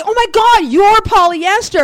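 high, loud burst of delighted surprise; retold amazed recognition. Star suddenly recognizing his tweeting fan, loud amazement. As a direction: exclaim it loudly, imitating an astonished star.

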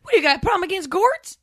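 high, loud hillbilly taunt; mock Southern challenge. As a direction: pick a fight over gourds. S1 in the bit.

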